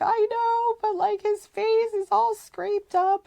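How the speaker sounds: very high, strained, near-sobbing voice; tearful wail. Retold or imitated. Mother crying to the sitter about her son's face, high and tearful. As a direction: speak through tears. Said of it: "I was a mess".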